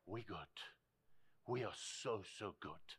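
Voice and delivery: very soft, low reassurance; tender assurance. Retold or imitated. Preacher voices God looking at you and me, tender. Voicing God's tender words to people.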